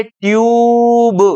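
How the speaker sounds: "ट्यूब" with the यू held long; long U demo. Shown as a demonstration. Teacher shows students the U sound, "ट्यूब" drawn out. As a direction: stretch "ट्यूब" slowly through the vowel. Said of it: "यहाँ यू को हम यू बोलेंगे".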